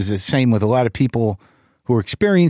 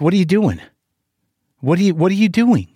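higher, pressing questions; exasperated demand; 6 semitones higher than usual. Retold or imitated. Confronting father on phone.

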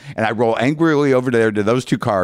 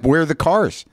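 higher, pushy demand at the clerk; raised voice at the rental counter; angry demand; 7 semitones higher than usual. Retold or imitated. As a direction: insist on the cars, exasperated.

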